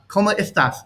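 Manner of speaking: ¿Cómo estás? with the s of estás lisped to th; Castilian lisp. Shown as a demonstration. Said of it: "are said like".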